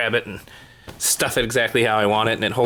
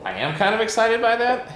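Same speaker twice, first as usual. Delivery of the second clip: higher, rising with real enthusiasm on "by that"; genuinely excited; 10 semitones higher than usual. Real, not acted. Vlogger admitting he's excited about toilet parts, voice lifting higher. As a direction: insist it, actually thrilled. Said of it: "I really say that like it's a question because".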